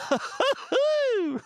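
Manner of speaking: high-pitched giggling whoop; high laughter. Real, not acted. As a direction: crack up into a high hoot. Cracking up at own voice.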